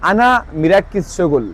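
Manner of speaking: আনা মিরাক্কিস শগুল said slowly, word by word; slow Arabic phrase. Shown as a demonstration. Teacher repeats the phrase slowly for viewers. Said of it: "মিরাক্কিস মানে আপনি খেয়াল করতেছেন".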